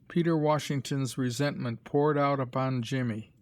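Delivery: ordinary delivery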